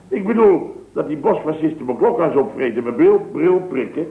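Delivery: ordinary delivery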